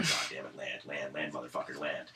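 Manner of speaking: low, soft desperate muttering; muttered plea. Retold or imitated. Nauseous passenger pleading the plane to land, low muttering. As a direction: grumble under your breath, begging the plane down. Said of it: "And I'm just like".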